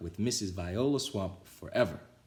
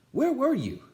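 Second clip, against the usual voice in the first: put-on excited kids; 6 dB louder than usual. A narrator's reading. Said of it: "all the kids".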